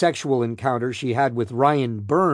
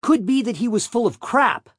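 high-pitched angry retort; flash of anger; 9 semitones higher than usual. A narrator's reading. Defendant dismissing the other man's testimony, flash of anger. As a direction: flare up, voice jumping higher. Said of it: "answered in a flash of anger".

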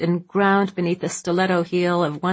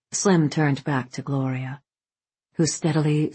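ordinary delivery, nothing marked

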